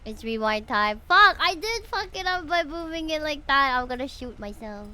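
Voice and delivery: ordinary delivery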